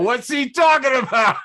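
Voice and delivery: loud, high incredulous yell; incredulous shout. Retold or imitated. Retelling his baffled reaction to a friend's comment, loud high shout.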